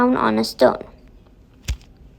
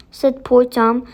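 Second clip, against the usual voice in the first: usual speaking voice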